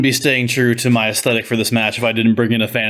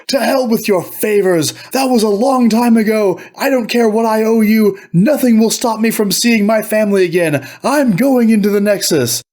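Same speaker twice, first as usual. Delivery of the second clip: furious ranting villain; 10 semitones higher than usual. Acted. Soren rages at the Marquis over old favors, high furious outburst. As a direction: rage that nothing will stop him.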